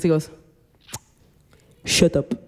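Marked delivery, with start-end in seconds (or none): 1.9-2.3 low, understated dismissal; imitated cab driver. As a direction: drop it low, with pauses between. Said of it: "the cab driver goes like this".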